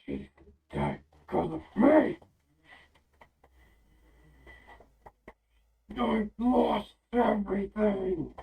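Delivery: loud, slow growling cry of grief; guttural growling voice. Acted. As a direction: roar it out in anguish, slow and heavy. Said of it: "in this guttural, like, growling voice say".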